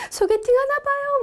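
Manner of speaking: high, lively teasing aside; playful tease. Acted. Teacher teasing about a blind date, high and lively. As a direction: tease the students brightly.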